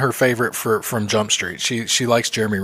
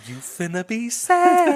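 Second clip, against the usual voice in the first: much higher, very slow sing-song taunt; teasing warning repeated; 10 semitones higher, much slower than usual. Retold or imitated. Repeating the warning to her, slow and sing-song. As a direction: draw it out, singing the told-you-so. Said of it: "I said".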